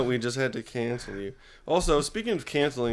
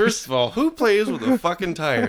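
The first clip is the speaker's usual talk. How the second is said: mock indignation; 7 semitones higher, 4 dB louder than usual. Mock indignant rant about a novel.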